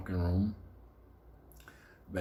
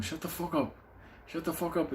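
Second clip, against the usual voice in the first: higher, urgent shout; mock hush yell; 8 semitones higher than usual. Benny telling the screaming guy to shut up, higher urgent shout. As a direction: shut him up fast.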